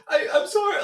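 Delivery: high, loud retold apology; flustered apology. Driver to the man screaming at him, flustered apology. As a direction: blurt it high, stumbling on the start.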